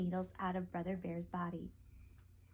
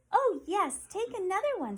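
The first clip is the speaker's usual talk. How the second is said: much higher, louder, eager yes; cheerful porcupine; 11 semitones higher, 7 dB louder than usual. Acted. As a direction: hand it over happily as a little porcupine. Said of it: "said Penny".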